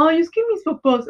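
whiny dependent self. Retold or imitated. Mocking her younger self who hid behind her parents, lightly whiny. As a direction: poke fun at your younger whining self. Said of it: "yo siempre era como".

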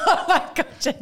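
high, laughing groan of embarrassment. Real, not acted. Singer put on the spot about her toilet song, laughing embarrassment. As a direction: protest through a laugh, pitched high. Embarrassed at being asked to sing.

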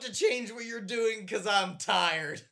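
whiny sleepy passenger. Retold or imitated. Mocking sleepy passenger's complaint.

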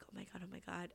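very soft oh my god under breath; hushed shock. Retold or imitated. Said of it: "And then I was like".

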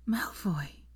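breathy whispered name; breathed. A narrator's reading. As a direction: barely breathe his name in shock. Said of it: "she breathed".

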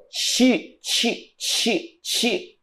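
"Chi" repeated in short, soft, separate bursts; "chi, chi" as a teacher isolating the sound; phonetic sounding-out. Shown as a demonstration. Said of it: "Numa reprodução fonética".

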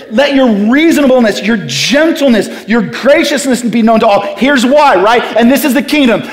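rally the congregation with ringing conviction; impassioned preaching. Real, not acted. Preacher leading the church in a refrain, impassioned.

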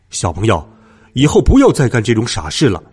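low-voiced man, casual after a scolding; grown-up talking down to a child, then curious; low, easygoing man's voice; bearded zookeeper, mildly admonishing.

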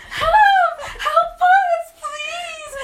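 high, loud, laughing panic cry; mock-terrified yelling through laughter; screaming for help. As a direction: shriek for help, half laughing. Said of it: "my cousin and I are like".

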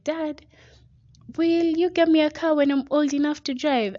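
mock a thirteen-year-old begging for a car; excited daughter asking. Daughter asking her dad for a car, high and eager. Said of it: "she was like".

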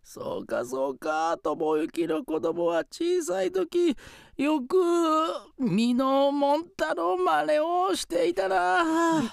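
put-on high, slow excited old ghost; joyful spirit grandpa.

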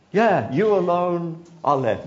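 usual speaking voice

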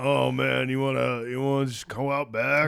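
slow, laid-back celebrity impression; Seth Rogen. Actor as Donkey Kong greeting Mario, laid-back impression. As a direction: drag it out like a mellow stoner actor. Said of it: "old Seth Rogen's doing it".

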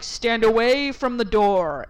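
loud, slow command; imitated fireman's order. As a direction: call it firmly through a door. Firemen ordering through locked door.

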